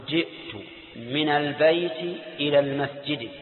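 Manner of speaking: slow careful "al-bayti ila l-masjidi"; genitive kasra endings. Shown as a demonstration. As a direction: end البيت and المسجد in a short "i". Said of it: "وحرف الجر يجر".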